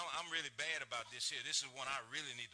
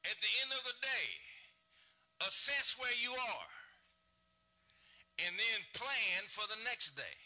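high, loud and slow pulpit delivery; full-force preaching; 6 semitones higher, 5 dB louder than usual. Acted. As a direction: lay out the lesson with weight. Preaching with raised voice.

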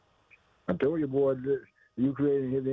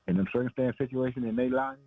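ordinary talk, nothing marked

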